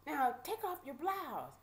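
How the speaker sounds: high-pitched barked order; imitated sheriff's holler. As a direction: mimic a mean guard giving orders. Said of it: "they're still screaming and hollering".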